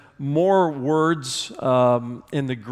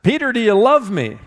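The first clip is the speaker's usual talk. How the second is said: higher and louder, pressing the question; Jesus asks again, raised; 7 semitones higher, 5 dB louder than usual. Retold or imitated. Jesus asks Peter a third time, raised and pressing. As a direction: lift the voice and press him. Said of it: "And then a third time".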